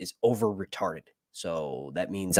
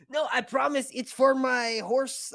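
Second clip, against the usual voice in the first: high, slow, put-on Spanish accent; mock Spanish accent; 13 semitones higher, 4 dB quieter, slower than usual. Customer assuring the pet store about horse medicine, mock Spanish accent. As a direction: plead innocently in a hammed-up Spanish accent. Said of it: "I did a Spanish accent".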